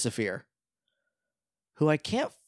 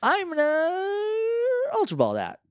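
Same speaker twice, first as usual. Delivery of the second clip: high silly voice; much slower than usual. Acted. Silly sung exclamation.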